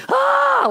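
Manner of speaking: high hammed-up scream of panic; mock scream. Pastor voicing disciples' mock scream at sleeping Jesus. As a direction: shriek like a frightened disciple. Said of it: "shouting, screaming".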